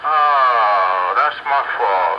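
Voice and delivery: ordinary talk, nothing marked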